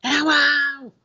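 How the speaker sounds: high-pitched, drawn-out hello; calling out. Real, not acted. Streamer checking a lost connection, calling out high. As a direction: call out to check if anyone is there.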